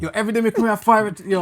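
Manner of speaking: loud, fast, laughing Jamaican patois; Jamaican accent. Acted. Jamaican regular complaining about the curry goat, loud Jamaican accent. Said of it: "you gotta do it like that".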